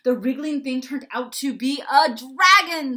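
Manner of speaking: high, very loud, excited reveal; dramatic storyteller. A narrator's reading. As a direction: announce the twist with gusto. Charlie reveals the dragon.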